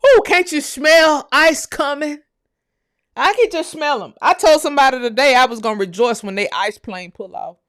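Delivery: high, loud elated outburst; rejoicing. Real, not acted. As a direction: crow it gleefully, voice up high. Rejoicing through tears of joy.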